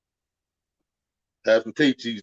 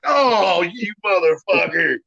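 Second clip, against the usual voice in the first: high, loud, drawn-out groan of protest; mock-horrified holler, trailing down slowly; disgusted outcry; 7 semitones higher, 5 dB louder, slower than usual. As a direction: cry out like you just walked into a stench. Acted reaction to stinking restroom.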